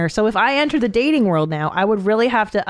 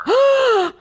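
sharp appalled intake of breath; horrified gasp. Real, not acted. Horrified at toilet story.

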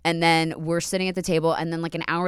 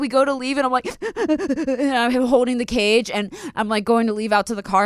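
normal talking voice

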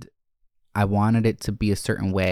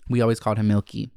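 ordinary talk, nothing marked

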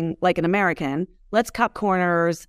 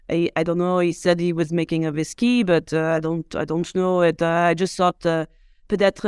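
put-on reading an email in a French accent. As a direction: put on a halting French accent with little hesitations. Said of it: "I read them with a French accent".

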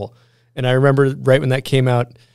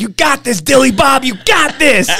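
cheering on; 11 semitones higher, 4 dB louder than usual. Retold or imitated. Mimicking encouraging friend.

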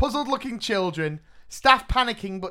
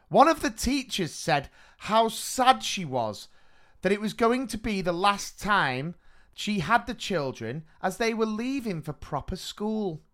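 reading a listener story; 3 semitones higher, 5 dB louder than usual. Acted. Reads a sent-in story.

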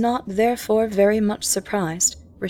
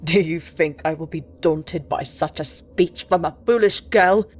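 lower, sneering captain voice; scornful; 3 semitones lower, 3 dB louder than usual. Acted. Captain sneering at the diarist, scornful. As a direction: sneer at the foolish girl. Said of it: "he answered scornfully".

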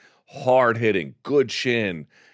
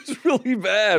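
higher voice, real laugh breaking in; amused, high-pitched laughing agreement; 8 semitones higher than usual. Real, not acted. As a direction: laugh while admitting it. Laughing over a bad fight.

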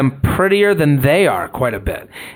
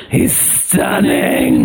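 much lower, slow raspy aunt; smoker aunt voice; much slower than usual. Acted. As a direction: croak it like a haggard smoker. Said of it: "do an aunt impression".

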